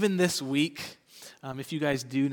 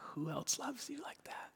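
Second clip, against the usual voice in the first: whisper; 9 semitones higher, 12 dB quieter than usual. Retold or imitated. Whispering to daughter at her crib.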